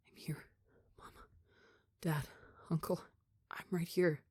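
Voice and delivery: put-on boy whispering to his rescuers; weak whisper. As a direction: reach out with what voice is left. Weak captive calling to family.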